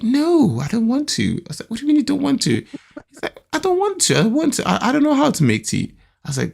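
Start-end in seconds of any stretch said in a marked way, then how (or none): 0.0-1.3 put-on refusing child. Voices son refusing chore. 1.7-2.6 high-pitched, disbelieving question; incredulous father. Retold or imitated. Retold father challenging refusal. 3.5-4.7 high-pitched imitation of a son's refusal; protesting child. Voices son protesting. 4.7-5.9 high-pitched imitation of a helpless son; protesting child.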